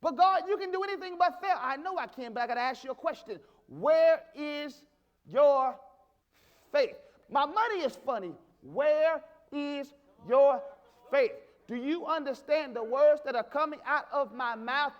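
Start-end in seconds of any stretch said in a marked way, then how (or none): 3.7-7.0 loud, drawn-out words with hard pauses; booming challenge. Real, not acted. Preacher presses the church about their faith, booming challenge. 8.6-11.3 loud, drawn-out words with hard pauses; booming challenge. Real, not acted. Preacher presses the church about their faith, booming challenge.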